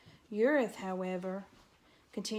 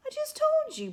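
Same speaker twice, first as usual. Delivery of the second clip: much higher and louder, desperate; pleading; 7 dB louder than usual. A narrator's reading. Terrified mouse begging the porcupine, pleading. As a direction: beg him to understand. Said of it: "Poppy pleaded".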